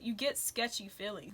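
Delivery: ordinary talk, nothing marked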